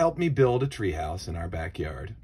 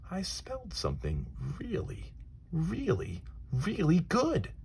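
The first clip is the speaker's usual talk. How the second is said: delighted surprise; 10 semitones higher than usual. Acted. Boy smells pie, delighted.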